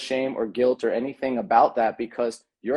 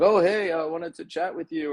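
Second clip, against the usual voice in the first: higher-pitched imitation of a passerby striking up chat; chatty stranger; 6 semitones higher than usual. Stranger approaching someone walking down the street, chatty mock voice.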